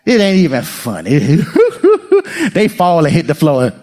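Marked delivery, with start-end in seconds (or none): 1.4-2.5 high, bursting giggle; childlike giggle. Shown as a demonstration. Showing children laugh.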